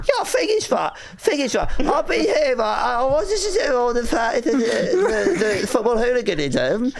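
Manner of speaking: very high, strangled bad Cockney; terrible Cockney accent. Nervous comedian replaying his failed hooligan part, awful Cockney. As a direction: stumble through a hopelessly fake Cockney. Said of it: "my Cockney accent's just a bad impression".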